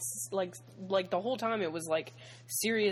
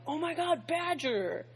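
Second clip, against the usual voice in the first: excited; 10 semitones higher than usual. Retold or imitated. Retelling excitement at seeing Badger.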